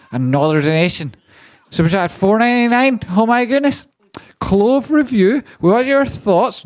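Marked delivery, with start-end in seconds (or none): 1.7-6.6 a syrupy, over-the-top thank-you voice reading out donations; gushing high voice. Acted. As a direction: streamer hamming up thanks in a squeaky high voice. Reads donation in put-on voice.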